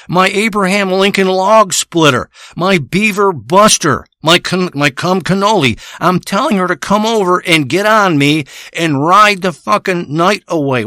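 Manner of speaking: ordinary talk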